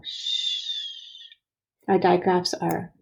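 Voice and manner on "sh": "Sh" as one long unvoiced hushing sound; sounding out digraph. Shown as a demonstration. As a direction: hold the consonant of "Sh" with no vowel. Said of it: "Think about what two letters go".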